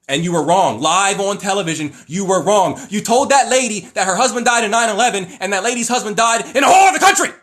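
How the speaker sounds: building from firm to a loud, high outburst; rising real outrage at the psychic; indignant rant. Real, not acted.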